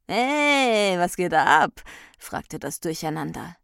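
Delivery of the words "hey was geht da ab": loud, stretched-out hey; cheeky party-goer. A narrator's reading. Durcheinander greets the angry Ordnung, cheeky and loud. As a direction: call out like a rowdy troublemaker.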